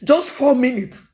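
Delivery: usual speaking voice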